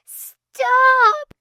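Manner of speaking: high, loud desperate plea; drawn-out high pleading shout; begging cry. A narrator's reading. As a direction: cry out, begging them to stop. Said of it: "she begged".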